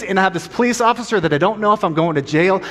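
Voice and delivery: ordinary talk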